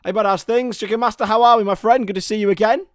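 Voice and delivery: big cheery welcome to a friend; excited greeting. Real, not acted. Streamer greeting viewer Chicken Master in chat, excited.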